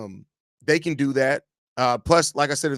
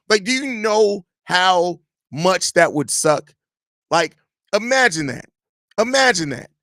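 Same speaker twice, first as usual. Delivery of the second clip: indignant rant; 5 semitones higher, 5 dB louder, slower than usual. Real, not acted. Ranting about the coach rumor.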